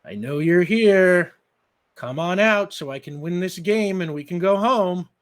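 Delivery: loud sing-song calling out; teasing call. Acted. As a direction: coax her out, smiling. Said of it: "And he smiles".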